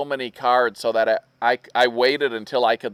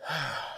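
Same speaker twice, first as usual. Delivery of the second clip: soft, easy exhale; relaxing sigh; 12 dB quieter than usual. Shown as a demonstration. Husband showing his no-reason sigh, soft and relaxing. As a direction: let out a sigh just to relax. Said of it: "just relaxing".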